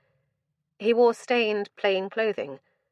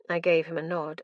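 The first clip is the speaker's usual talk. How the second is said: usual speaking voice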